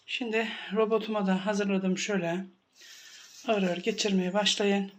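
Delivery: ordinary talk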